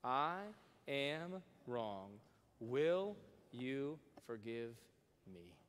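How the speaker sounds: very slow, fading, sincere; humble, slow confession led together; slow sincere plea. Shown as a demonstration. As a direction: say it slowly, meaning it. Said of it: "Can you say that with me".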